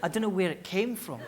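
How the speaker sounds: ordinary talk, nothing marked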